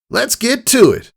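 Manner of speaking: ready to launch into the sky; eager Rainbow Dash. A narrator's reading.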